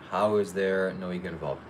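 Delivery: ordinary delivery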